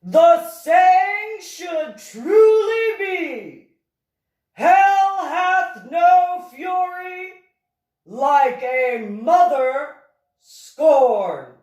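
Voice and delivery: very high, loud, long-held ominous wail; ominous proclamation. A narrator's reading. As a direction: proclaim it like a doom prophecy, stretching each word very slowly. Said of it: "prior to stating ominously".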